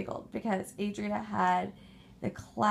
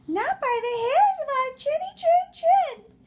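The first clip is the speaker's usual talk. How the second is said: high, loud squeaky pig; defiant squeal of the little pigs; 9 dB louder than usual. Acted. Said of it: "June and Nene said".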